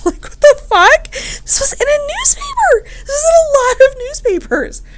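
very high squeal through laughter; laughing disbelief. Real, not acted. Host reacting to the printed divorce notice, high incredulous laughing squeal.

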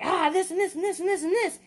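high, rushed babble; rambling excitedly. Retold or imitated. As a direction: pour it all out in a gush. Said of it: "and I'm like".

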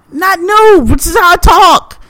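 high, exasperated correction; real exasperation in an imagined retort; indignant comeback. Acted. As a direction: push back in high-pitched protest. Indignant answer to imagined critic.

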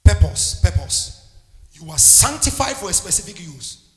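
loud declaring preacher's call; loud preaching. Acted. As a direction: proclaim it like a banner over them. Preaching at full force.

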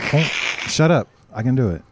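normal talking voice

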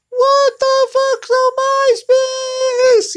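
very high, slow, strained squeal; comic falsetto shriek of confusion; squeaky falsetto disbelief. Retold or imitated. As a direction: squeal it out in mock shock. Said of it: "And I'm like".

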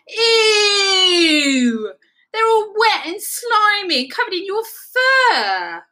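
high, loud squeal of disgust. A narrator's reading. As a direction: recoil in horror. Rabbit disgusted by wet carrots.

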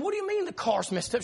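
mildly exasperated, questioning the wife without raising volume; mock frustration. Husband questioning wife about the car, retold frustration. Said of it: "I get frustrated".

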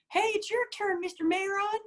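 put-on very high-pitched child's squeal; piping kid voice, way up high; little kid. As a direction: imitate a little kid calling out in falsetto. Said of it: "that little kid on the half pipe".

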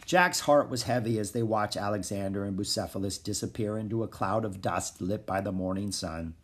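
ordinary delivery, nothing marked

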